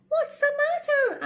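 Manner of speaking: high worried little pig; concerned pig. Acted. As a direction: ask gently with concern. Pig asks mouse.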